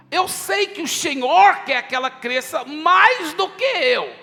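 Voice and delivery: impassioned plea. Retold or imitated. Pastor reliving his prayer to God, a fervent rising plea.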